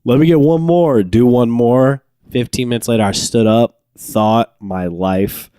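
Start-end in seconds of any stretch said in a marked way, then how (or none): 0.0-2.0 high, insistent boast; mock cocky kid wanting more; drunken bravado. Eighth grader six shots in, mock bravado.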